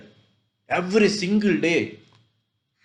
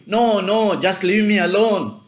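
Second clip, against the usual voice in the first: imitating a child's shouted refusal, only a little raised; mild mock shout; 2 semitones higher, 4 dB louder than usual. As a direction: lightly act out a son's defiant shout. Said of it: "but shouting".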